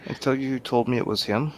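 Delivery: usual speaking voice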